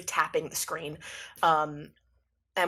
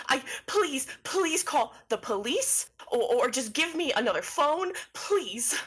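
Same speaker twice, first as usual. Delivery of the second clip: higher, urgent desperate begging; frightened pleading; 6 semitones higher than usual. Acted. Cosmo begs a stranger to call the police, frightened pleading. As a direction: plead for help, voice pitched up. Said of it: "they've seen a ghost".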